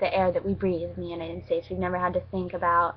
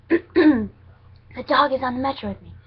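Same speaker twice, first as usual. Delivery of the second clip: slow, loud and high, spelling it out; louder repeat; 5 semitones higher, 6 dB louder, slower than usual. Retold or imitated. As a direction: say it slowly and louder so mom gets it.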